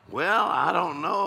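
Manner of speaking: high, loud, drawn-out mock puzzlement; mock uncertainty. Preacher answering a doubter about the Bible, mock-uncertain.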